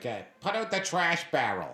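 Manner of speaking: put-on high-pitched Boston accent. Quoting a friend in Boston, high Boston accent. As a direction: call it out like a Bostonian. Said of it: "I got a friend in Boston".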